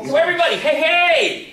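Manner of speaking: loud, high rallying cheer; calling out. Real, not acted. Host rallies everybody in the audience, loud calling out. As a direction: holler to rouse the whole crowd.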